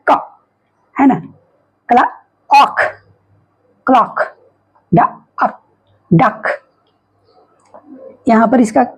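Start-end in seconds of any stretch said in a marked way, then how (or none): 0.1-0.2 "क" as one short k sound. Shown as a demonstration. Teacher showing learners the CK sound, short "क". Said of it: "यहाँ सी के का उच्चारण क्या है". 1.9-6.5 "क्ला-", pause, "ऑक", then whole "क्लॉक"; phonics blending. Shown as a demonstration. Teacher blending CK words, "क्लॉक" and "डक" sounded out with pauses. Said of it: "यहाँ सी के का उच्चारण क्या है".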